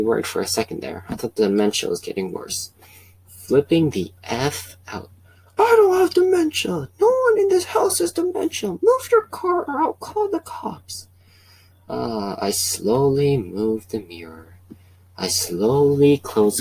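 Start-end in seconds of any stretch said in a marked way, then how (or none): 5.6-11.1 high, loud cranky neighbor threat; Kevin voice. Acted. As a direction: shriek the threat as the old neighbor. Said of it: "my Kevin voice".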